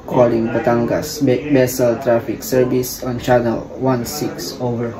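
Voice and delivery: very loud call over the radio. Acted. Ship hailing traffic service on radio.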